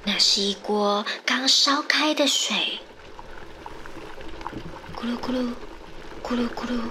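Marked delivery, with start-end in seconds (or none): 4.9-6.8 soft bubbling sound. Acted. Imitating boiling water bubbles.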